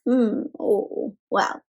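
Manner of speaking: ordinary delivery, nothing marked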